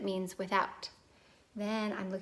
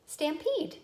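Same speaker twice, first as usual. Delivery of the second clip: "Stampede" in one go, higher-pitched; brisk blended word; 8 semitones higher, 4 dB louder than usual. Shown as a demonstration. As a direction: say "Stampede" quickly and high, syllables joined. Blended word after slow take.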